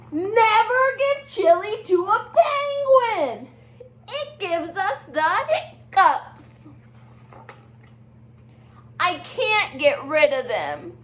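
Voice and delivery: bright, chatty penguin voice with hiccup fuss; excitable Little Penguin. Acted. Teacher reading aloud as Little Penguin to her class. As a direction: play the fretful little penguin.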